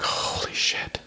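breathless whispered shock; stunned whisper. Retold or imitated. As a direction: whisper it as the fear sinks in. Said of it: "I was just like".

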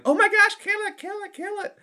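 high panicked squeal, kill it; shrieking schoolgirls in a panic; squealing girls. Acted. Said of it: "all the girls start kinda like".

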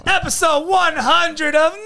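high, slow, big hype; way too much energy; overexcited intro. Shown as a demonstration. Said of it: "tone the energy down".

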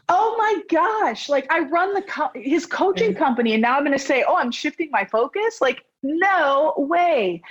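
no way, never; aghast. Real, not acted. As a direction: pitch up high in horror. Aghast at quitting coaching company.

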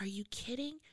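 softly whispered "are you kidding"; whispered disbelief. Retold or imitated. Mother hearing the news on the phone, whispered disbelief. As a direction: gasp it out under your breath. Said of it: "she's like".

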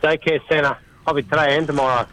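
normal talking voice

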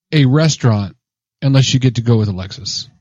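normal talking voice

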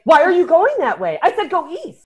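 high, loud, bossy snapping; bitchy mock scolding. Wife ordering husband around on the race, mock scolding. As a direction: snap at a partner in mock exasperation. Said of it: "I'd look like a total bitch".

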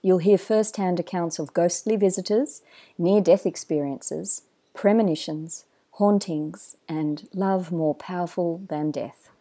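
polished announcer read over music; smooth, measured podcast host intro. Acted. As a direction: draw the listener in like a show opener.

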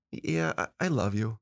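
low, hesitant, unconvincing mumble; half-hearted. Shown as a demonstration. Dad showing daughter an empty "I love you", half-hearted. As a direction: say it without really meaning it. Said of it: "I didn't really say it".

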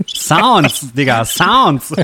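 loud, slow, drawn-out hype; hyped announcing. Real, not acted. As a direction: call it out like a hype man. Showing off the soundboard controls.

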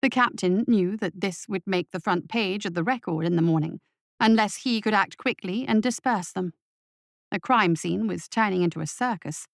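plain reading voice

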